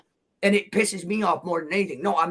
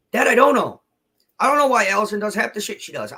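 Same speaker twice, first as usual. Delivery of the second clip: getting loud; 4 semitones higher, 5 dB louder, faster than usual. Real, not acted. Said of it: "I'm not trying to get loud with you".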